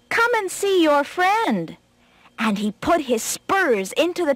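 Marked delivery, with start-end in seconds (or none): 0.1-1.8 high, drawn-out call to a friend; singled-out words stretched in a high call. Acted. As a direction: call it out slowly in a raised pitch. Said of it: "the rabbit called to the fox".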